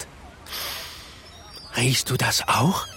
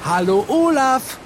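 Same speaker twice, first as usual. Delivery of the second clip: laut und hoch gerufen; rufen; 9 semitones higher than usual. Shown as a demonstration. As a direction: ruf laut hinüber zum Wal. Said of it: "Wir rufen laut". (laut rufend)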